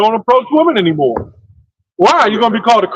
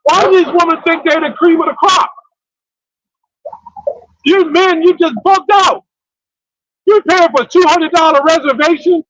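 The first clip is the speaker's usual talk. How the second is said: high-pitched, worked-up scolding; exasperated rant; 7 semitones higher than usual. Real, not acted. Man berating men who pay for dates, exasperated rant. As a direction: lecture them in heated, high-pitched exasperation.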